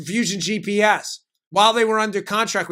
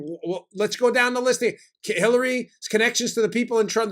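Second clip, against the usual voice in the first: ordinary talk, nothing marked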